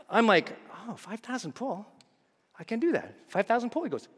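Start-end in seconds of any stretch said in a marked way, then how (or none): 0.9-3.8 soft, tentative questioning; small, hushed retold voice; meek checking. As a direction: repeat it quietly as if checking. Timidly repeating the instruction, acted.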